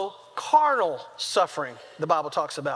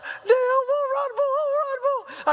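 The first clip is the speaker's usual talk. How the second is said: hammed-up falsetto sobbing; crying, screaming child. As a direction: imitate a boy bawling to his dad. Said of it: "he's crying, screaming".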